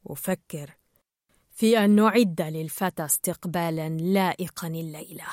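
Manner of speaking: brooding mentor. A narrator's reading. Gill reveals tonight's plan to Peach, low and pensive.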